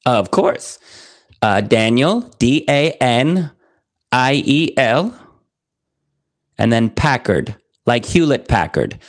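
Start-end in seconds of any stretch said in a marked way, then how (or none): 2.4-5.1 slow, letter by letter; spelling it out. Shown as a demonstration. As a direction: spell each letter clearly. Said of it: "can you spell that Daniel Packard".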